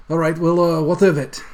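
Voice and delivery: same Scottish brogue, guarded; Scottish innkeeper, wary and loud; Scottish accent. Acted. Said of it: "continuing on like that".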